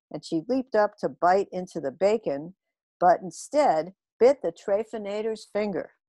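usual speaking voice

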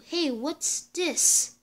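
ordinary delivery, nothing marked

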